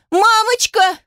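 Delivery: very high, piercing wail; anguished child's cry. A narrator's reading. Daughter carried off by guards, anguished screaming. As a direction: scream out for mother. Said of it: "Плач Алисы становился надрывистее".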